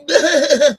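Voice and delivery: put-on cackle at a chatter; high, mocking forced laugh; mocking fake laugh. Mocking a chatter who keeps writing laughs, jeering fake laugh.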